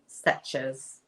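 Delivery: "Stitches" echoed as "stetches"; Scottish lady's pronunciation. Retold or imitated. Enjoying how the Scottish lady says it, imitated pronunciation. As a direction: fondly copy her "Stitches" with an e. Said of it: "so cute how she says it".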